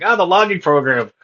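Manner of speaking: high, loud imitated outburst; hammed-up exasperated yell; mock raging. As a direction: re-enact your own rant at the software. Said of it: "I would just start raging".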